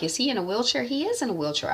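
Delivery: quietly taken aback; surprised hush. Real, not acted. Viewer noticing the wheelchair, soft surprised aside. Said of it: "I'm like".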